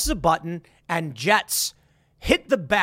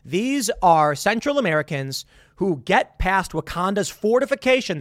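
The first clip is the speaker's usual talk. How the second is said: normal talking voice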